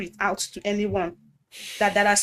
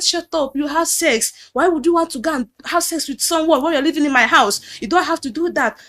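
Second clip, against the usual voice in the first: angry retold pastor; 6 semitones higher, 4 dB louder than usual. Pastor shouting accusations.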